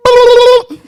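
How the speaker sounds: very high, loud squeaky boop; a piercing cartoon boop; silly boop. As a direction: answer with a cute toy-like beep. Wife's answer noise.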